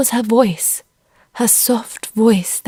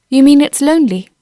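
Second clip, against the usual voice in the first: usual speaking voice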